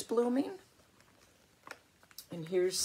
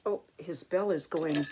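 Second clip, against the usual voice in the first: plain reading voice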